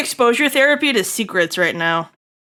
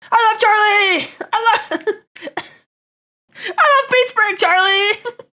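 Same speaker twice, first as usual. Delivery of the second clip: excited cheer; 9 semitones higher than usual. Real, not acted. Streamer cheers for Charlie to chat, high excited shouting. Fan cheering a favourite character.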